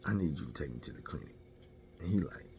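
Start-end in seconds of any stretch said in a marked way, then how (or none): 0.0-1.4 hushed ask. Retold or imitated. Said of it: "I'm like".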